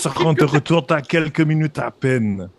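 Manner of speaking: French spoken with a put-on German accent. Impostor answering the guard on the radio, German accent. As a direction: cover up calmly in a German accent. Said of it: "il parle en français avec un accent allemand".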